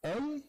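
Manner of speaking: "Om" held high as a nasal vowel. Shown as a demonstration. Teacher demonstrating the vowel of the N. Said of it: "eu vou dar a vogal do om".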